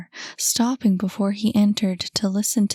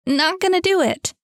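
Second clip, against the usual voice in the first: much higher and louder yell; yelled even harder; 10 semitones higher, 5 dB louder than usual. A narrator's reading. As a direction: scream it out at the screen. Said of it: "yelled Cam".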